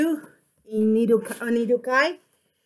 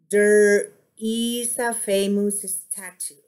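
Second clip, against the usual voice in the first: slow model sentence falling on "statue"; deliberate falling end on "statue"; statement intonation; 2 semitones lower than usual. Shown as a demonstration. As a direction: draw it out and drop the voice on "statue". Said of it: "look at the way I do this".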